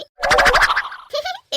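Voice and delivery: high, loud burst of laughter; loud squealing laugh. Real, not acted. Girls teasing friend about kissing, loud squealing laugh. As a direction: shriek with laughter at the joke.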